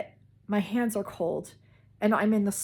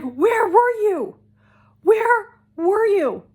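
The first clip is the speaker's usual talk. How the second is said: much higher, louder and slow, a panicked mother; frantic mom; 12 semitones higher, 10 dB louder, slower than usual. Retold or imitated. Mom questioning her missing daughter, frantic high slow shout. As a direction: plead it out in frantic relief. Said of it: "she is frantic".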